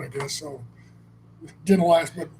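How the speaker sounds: ordinary talk, nothing marked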